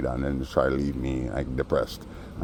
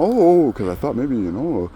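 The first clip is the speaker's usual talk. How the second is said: 1 mock innocent voice; 9 semitones higher, 6 dB louder, slower than usual. Mocking the guy's prying.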